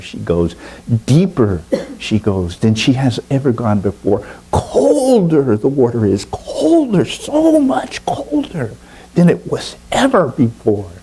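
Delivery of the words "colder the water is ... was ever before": high, awed narration of the coldest dive; building storyteller awe. A narrator's reading. Narrator tells the deepest dive, high and awed. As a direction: push each colder higher and fuller.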